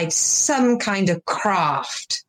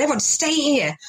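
urgent instruction; 7 semitones higher than usual. Retold or imitated. Calling out instruction to classmates, retold.

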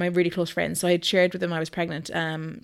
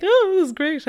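high, louder delighted squeal; excited friends; 12 semitones higher, 6 dB louder than usual. Retold or imitated. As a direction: gush like thrilled friends. Friends cheering the news.

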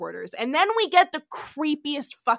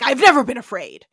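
macho bravado; 13 dB louder, faster than usual. Retold or imitated. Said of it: "compensating much".

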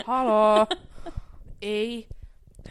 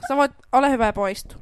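mock scolding; 3 semitones higher, 10 dB louder than usual. Real, not acted. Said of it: "Karo naurattaa".

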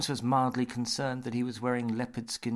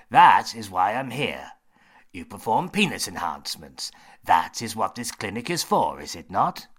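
James voice; 8 dB louder than usual. Acted.

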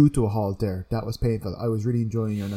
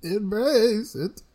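mock ominous; 9 semitones higher, much slower than usual. Hamming up the chaos line.

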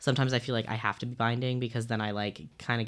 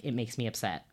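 normal talking voice

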